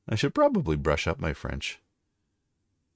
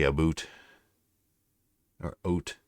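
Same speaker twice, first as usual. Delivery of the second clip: Canadian accent. Shown as a demonstration. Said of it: "As you can probably tell if I say".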